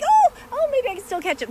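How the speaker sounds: high, loud burst of hope; replaying her own thrilled thought; eager self-quote about catching them; excited hope. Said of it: "I'm like".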